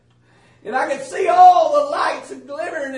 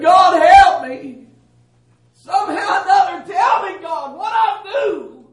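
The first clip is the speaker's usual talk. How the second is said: crying out; 5 semitones higher, 8 dB louder than usual. Retold or imitated. Man unable to choose a road begs God, loud desperate cry. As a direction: plead with God at full voice, pitch raised. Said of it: "I began to cry out".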